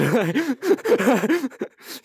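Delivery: loud, high giggling laugh; high burst of laughter. Real, not acted. Laughs at stuffing argument.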